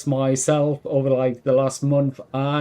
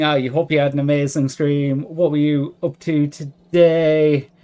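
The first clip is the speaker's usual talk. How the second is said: normal talking voice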